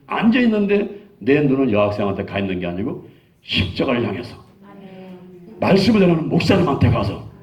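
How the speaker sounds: ordinary talk, nothing marked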